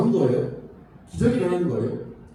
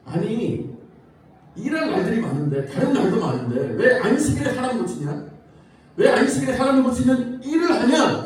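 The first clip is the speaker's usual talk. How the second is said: mock indignant demand, louder toward the end with a laugh; indignant challenge; 5 semitones higher, 5 dB louder than usual. Pharisees confronting Jesus over healing on the Sabbath, mock indignant challenge. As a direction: pick a fight, half-laughing, building to loud. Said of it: "그러면서 따지는 거예요".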